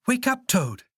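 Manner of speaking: high, insistent call; high-pitched wake-up call; waking someone. A narrator's reading. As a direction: rouse a sleeping friend. Frog wakes his dreaming friend.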